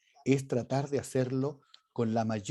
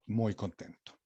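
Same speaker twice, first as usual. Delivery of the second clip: a flat, lower, softer "muy contento" that sounds anything but happy; unconvincing happiness; 6 semitones lower, 8 dB quieter than usual. Shown as a demonstration. Teacher shows students a joyless "muy contento". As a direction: say "muy contento" deflated, so the voice betrays the words. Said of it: "esa inflexión de voz a ustedes no les dice que estoy contento".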